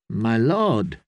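ordinary delivery

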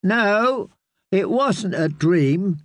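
put-on high, slow child voice pushing back; a frightened boy insisting; insistent boy. A narrator's reading.

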